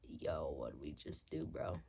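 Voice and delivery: low hushed whisper. Retold or imitated. Asking friend what they just did, whispered. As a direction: whisper it in disbelief. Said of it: "I was like".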